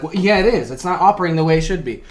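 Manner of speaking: mock mechanic reply.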